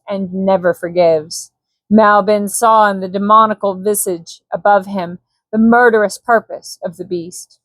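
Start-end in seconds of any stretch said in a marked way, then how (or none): none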